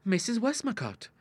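put-on high, sly girlish suggestion; arch, high-pitched teasing; slyly. A narrator's reading. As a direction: slip the name in slyly. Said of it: "suggested Ida, slyly".

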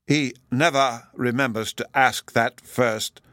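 usual speaking voice

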